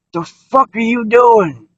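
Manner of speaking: high, drawn-out mock holler; retold angry yell. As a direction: imitate someone hollering at trespassers, high and stretched out. Retelling a morning yell outside.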